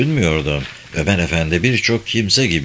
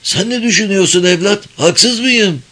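put-on higher, pleading old man's question; curious old man; 9 semitones higher than usual. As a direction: ask eagerly like an old man. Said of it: "merakına yenik düşerek sordu".

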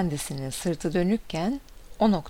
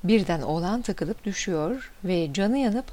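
plain reading voice

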